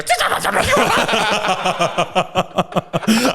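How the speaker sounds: high rapid gremlin gibber; imitated gremlin noise. Gremlins losing all their speech-therapy progress, high gibbering. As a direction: chatter like a gremlin, dissolving into laughter. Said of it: "They go".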